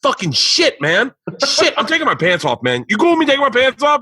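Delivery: high-pitched frustrated cursing rant; re-enacted fed-up swearing fit; exasperated outburst. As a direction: curse your own botched reading in a high, worked-up voice. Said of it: "they left in me going".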